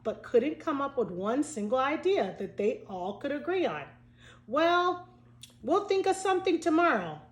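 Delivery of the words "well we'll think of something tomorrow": higher, lightly voiced reassuring kid; a kid's cheerful higher promise; assured child. A narrator's reading. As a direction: promise it like a confident schoolkid. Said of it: "Huntley assured everyone".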